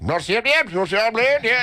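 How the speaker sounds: lightly put-on Dutch accent; a hint of a Dutch man's voice; mock Dutch accent; Dutch guy. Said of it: "he's like".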